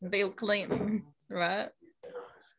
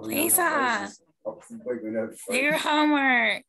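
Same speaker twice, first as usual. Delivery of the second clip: Marge Simpson; 4 semitones higher, slower than usual. Acted. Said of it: "it's Marge".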